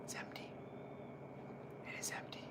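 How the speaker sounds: soft unvoiced whisper. Real, not acted. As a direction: whisper it to yourself. Whispering about empty cup.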